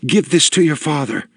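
a tearful woman begging a favour; earnest small woman. A narrator's reading. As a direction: press the gift on him urgently. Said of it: "sobbing face".

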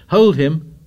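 loud, high urgent command; shouted order. A narrator's reading. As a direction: shout it to the mob. Frenzied boys seize the victim.

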